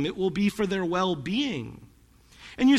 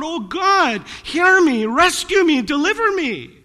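loud, higher pleading cry; desperate cry to God; 7 semitones higher, 6 dB louder than usual. Acted. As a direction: beg God for rescue, voice raised high. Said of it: "when David cries out".

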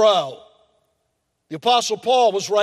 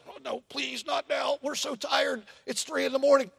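soft, higher, weary pleading; pleading whisper; 5 semitones higher, 6 dB quieter than usual. Retold or imitated. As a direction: beg quietly so the baby stays asleep. Tired parent pleading at night.

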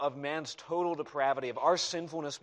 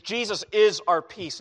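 ringing proclamation; 5 semitones higher, 9 dB louder than usual. Acted. Preacher proclaims key phrase again.

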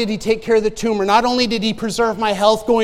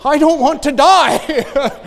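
slow, laughing protest; 4 semitones higher, 7 dB louder, much slower than usual. Real, not acted. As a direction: laugh it out, mock-scared. Laughing fear at chemo.

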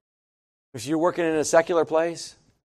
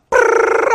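high, airless lip-buzz of a speeding car; engine buzz; 5 dB louder than usual. Retold or imitated. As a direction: blow a whispery motor buzz through your lips. Engine roar, 140 miles an hour.

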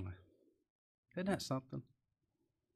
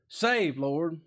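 desperate cry; 3 semitones higher, 4 dB louder than usual. Retold or imitated. Preacher voicing Peter crying to the Lord, a loud desperate plea. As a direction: call out like a drowning man. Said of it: "cry out in desperation like Peter".

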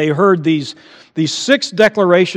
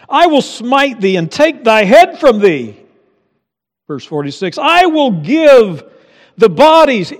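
mock biblical prophet; 7 semitones higher, 7 dB louder than usual. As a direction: thunder the prophecy. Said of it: "declarations of faith".

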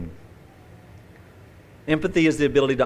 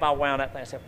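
normal talking voice

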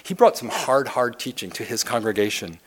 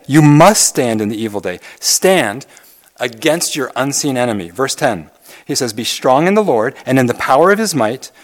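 louder, driving sermon delivery; fiery pulpit preaching; preaching at full force; 6 dB louder than usual. Acted. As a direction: press the scripture home like a rally cry. Preaches the armor passage.

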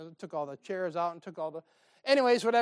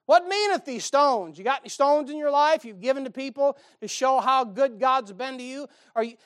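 louder, higher, fervent preaching; full-force preaching; 6 semitones higher, 8 dB louder than usual. Acted. Preacher challenging the congregation to testify, fervent. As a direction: drive the challenge home to the pews.

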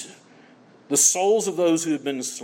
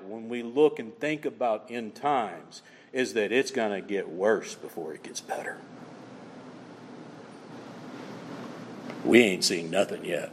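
hushed solemn preaching; 9 semitones lower than usual. Acted. Preacher consoling believers, low and grave. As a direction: let each short line sink in.

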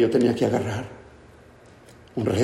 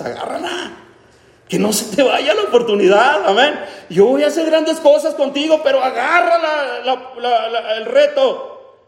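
voicing God; 11 semitones higher, 3 dB louder, slower than usual. Dios le dijo a Moisés.

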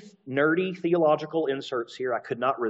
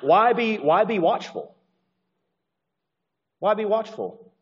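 mock question; 6 semitones higher than usual. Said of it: "imagined question".

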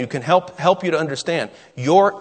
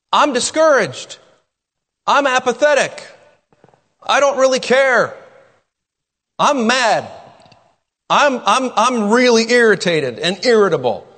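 high, raised-voice list of grievances; emphatic mock complaint; 7 semitones higher, 4 dB louder than usual. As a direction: declare each bad mood forcefully, pitched up. Voicing complaints of failing flesh.

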